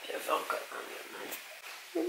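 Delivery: slow, tender whisper; whispering over a crib. Real, not acted. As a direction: coax softly, barely a breath. Asking the toddler for a cuddle. (chuchotement tendre et lent)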